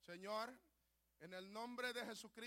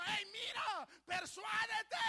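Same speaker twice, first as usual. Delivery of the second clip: higher, louder call; God calling out; 10 semitones higher, 7 dB louder, slower than usual. Retold or imitated. God calling to the sinner, loud and high. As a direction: call out to get attention. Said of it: "te está diciendo".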